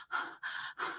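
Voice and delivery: soft, touched gasp; acted gasp. Shopper reacting to stranger's compliments, soft emotional gasp. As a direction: gasp as if moved by praise.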